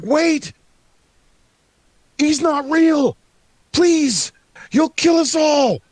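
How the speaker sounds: very high, loud panicked pleading; desperate gnome yell. Acted. Second gnome running down the hall to warn them, desperate shriek. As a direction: shriek a desperate warning at the top of your range.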